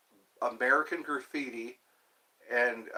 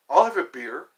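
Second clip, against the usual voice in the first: louder beer order; 8 dB louder than usual. Retold or imitated. Vlogger ordering in a pub, louder repeat. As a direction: repeat "I'll have a beer." louder, pushed out. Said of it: "Or you say".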